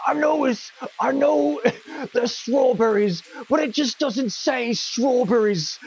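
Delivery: high, drawn-out imitation of a picky director, slowed; mock the director who won't let it go; fussy director. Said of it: "And the director's like".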